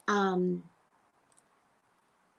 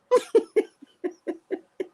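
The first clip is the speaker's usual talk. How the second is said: high burst of laughter; 13 semitones higher, 6 dB louder than usual. Real, not acted. Laughing at clone idea.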